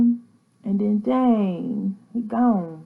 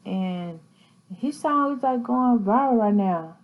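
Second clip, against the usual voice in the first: ordinary talk, nothing marked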